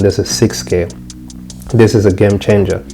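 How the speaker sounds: usual speaking voice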